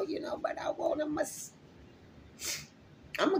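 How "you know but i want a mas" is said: breathy joking whisper, voice dropped right down. Real, not acted. Vlogger whispering her cheeky massage wish to viewers. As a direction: let the naughty bit slip out in a whisper.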